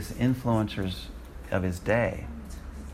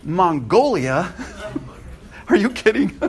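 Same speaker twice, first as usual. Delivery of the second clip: louder and higher, half laughing at the idea; incredulous surprise; 7 semitones higher, 4 dB louder than usual. Retold or imitated. Preacher reliving his reaction to God's call, incredulous laugh. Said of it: "And I went".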